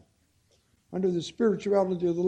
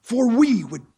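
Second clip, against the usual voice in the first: louder, rising preacher's fervour; fervent preaching; 4 semitones higher, 7 dB louder than usual. Acted. Preacher pressing the verse on his brothers and sisters, fervent. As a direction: preach it out to the pews.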